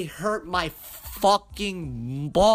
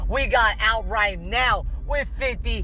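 pumped-up announcer voice, higher and louder; hype outro read; 4 semitones higher, 5 dB louder than usual. Acted. Host signing off listeners, louder, higher hype announcer read. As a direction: hype the show like a radio host. Podcast outro promo over music.

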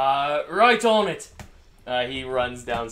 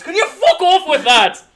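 loud, much higher yelled in pain; pained shout; 16 dB louder than usual. Acted. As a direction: scream it at her while hurting. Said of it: "and he goes".

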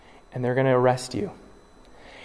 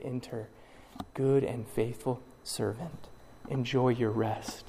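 much softer, slow and tender; gentle welcome; 3 semitones lower, 13 dB quieter than usual. Pretend. Lord welcoming faithful servant, soft and slow. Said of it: "And he will say".

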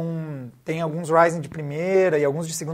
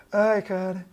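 ordinary delivery

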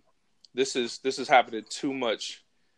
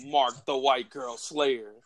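usual speaking voice

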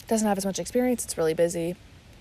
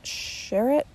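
"Sherritt" higher, rising as a question; 6 semitones higher than usual. Real, not acted. Podcaster unsure of the author's surname, questioning rise on "Sherritt". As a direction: try "Sherritt" tentatively, pitch lifting at the end.

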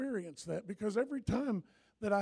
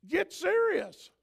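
higher, louder, slow and firm; loud exhortation; 9 semitones higher, 8 dB louder, slower than usual. Real, not acted. Pastor urges the congregation, loud and slow. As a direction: press it on the church. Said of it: "that's what I need to tell you".

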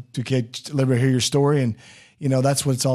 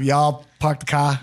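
put-on slow, drawn-out Texas-Boston mashup accent; Texas-Massachusetts accent; slower than usual. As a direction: mix a Texan y'all with Massachusetts dropped r's. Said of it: "Little Texas, Tex-, Tex, Massachusetts".

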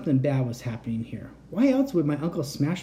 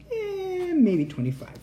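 playful tease; slower than usual. Real, not acted. Teasing outro.

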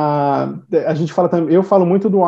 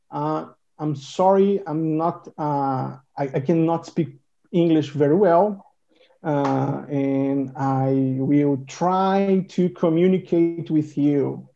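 inglês bem devagar e pausado; falando devagar; much slower than usual. Shown as a demonstration. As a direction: fala cada palavra com calma. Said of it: "A primeira dica que eu dou é que você fale devagar". (inglês lento e pausado)

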